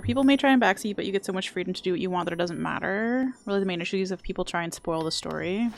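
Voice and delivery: ordinary talk, nothing marked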